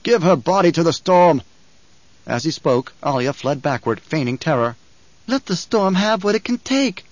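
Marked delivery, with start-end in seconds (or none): 0.0-1.4 loud cold command; angry emperor. A narrator's reading. Emperor orders. 5.3-7.0 high, slow feigned terror scream. A narrator's reading. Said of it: "she screamed".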